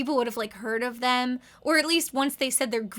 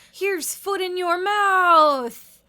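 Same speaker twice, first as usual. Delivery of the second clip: mock stilted line; 6 semitones higher, 7 dB louder, much slower than usual. Voicing comic line.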